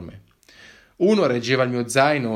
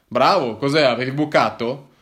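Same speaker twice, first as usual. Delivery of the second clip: louder, sarcastic officer voice; sarcastic border cop; 3 semitones higher, 7 dB louder than usual. Acted. Policeman needles the hiker about his trip, sarcastic. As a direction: play the smug cop teasing him.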